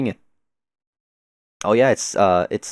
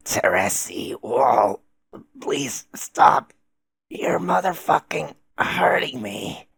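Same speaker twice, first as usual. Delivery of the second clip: strained whisper begging for it to stop; whispered plea; 5 semitones higher, much slower than usual. Acted. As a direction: plead in a hurt whisper. Acted hurt character pleading.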